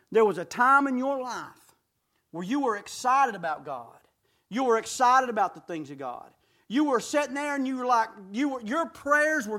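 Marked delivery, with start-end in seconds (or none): none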